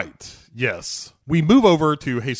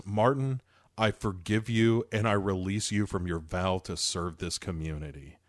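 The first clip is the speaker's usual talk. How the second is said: lower, much softer solemn tone; low quiet voice; 6 semitones lower, 8 dB quieter than usual. Retold or imitated. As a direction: hush it like a blessing. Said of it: "the lieutenant says".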